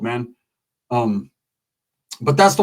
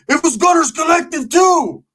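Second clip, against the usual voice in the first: high, slow, loud mock shout; 12 semitones higher, 5 dB louder than usual. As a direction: yell it out like an outraged streamer. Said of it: "the guy goes".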